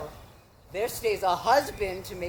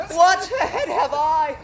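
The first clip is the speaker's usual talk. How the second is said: laughing complaint; 3 semitones higher, 5 dB louder than usual. Acted. Nurse complains of headache.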